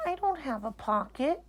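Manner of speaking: a puzzled toy bear; small teddy bear voice. Acted. Corduroy the bear realizing he has no pocket.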